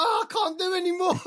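mock despairing cry, high and loud with a laugh; half-laughing wail of giving up; hammed-up worn-out dad; exasperated. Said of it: "I'm like".

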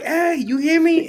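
high, loud call for attention. Retold or imitated. God trying to reach busy people, calling out. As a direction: imitate someone calling to a distracted listener.

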